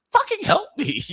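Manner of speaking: high squealing mock plea; hammed-up frantic cry for help; mock panicked plea. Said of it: "Julie Garner's like".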